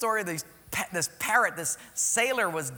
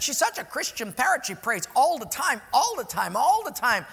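high, louder gushing lady; gushing church lady; 6 semitones higher, 5 dB louder than usual. Acted. Church lady praising her praying parrot, high gushing voice. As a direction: gush over how much she prays.

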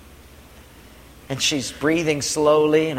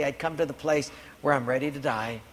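normal talking voice

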